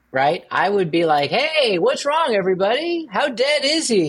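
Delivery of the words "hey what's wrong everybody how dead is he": goofing around. Pretend. Joking about what he would say to mourners, goofing around. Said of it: "I'd be goofing around".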